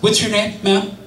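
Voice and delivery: ordinary delivery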